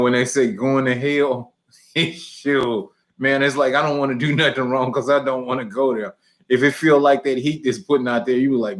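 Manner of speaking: normal talking voice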